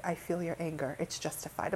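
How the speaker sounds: his calm reply. Retold or imitated. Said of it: "he's like".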